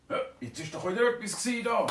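a curious dragon pointing out a noise; excited dragon. Acted. Dragon hears noise again. (leicht aufgeregter Drache)

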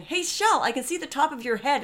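calling out high, like a cheery coach on Zoom; mock coach call-out. Coach greeting her on the video call, high cheery call-out. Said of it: "my coach going".

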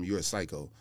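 usual speaking voice

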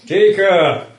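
loud call, high. Acted. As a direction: call her name across the room. GM calls out to character.

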